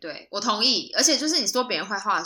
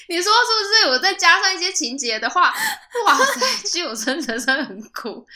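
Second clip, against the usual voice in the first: laughing; 9 semitones higher, 3 dB louder than usual. Real, not acted. Still laughing from the joke.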